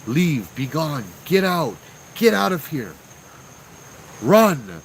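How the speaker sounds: imitating a voice yelling over static; yelled commands. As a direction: call out each warning in bursts. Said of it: "these are words that are being yelled".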